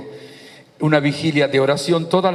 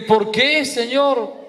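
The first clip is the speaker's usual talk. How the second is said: high-pitched, plaintive question to God; mock protest; 9 semitones higher, much slower than usual. Preacher retelling his question to the Lord, high mock protest. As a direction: retell it as a raised, drawn-out appeal.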